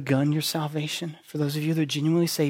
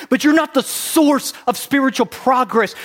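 loud preached point; 9 semitones higher, 9 dB louder than usual. Acted.

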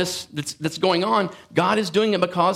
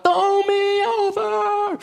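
high, slow, drawn-out cry; mock desperate plea; 13 semitones higher, much slower than usual. Preacher voicing Jonah to sailors, high drawn-out mock plea. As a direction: wail it like Jonah begging to go overboard.

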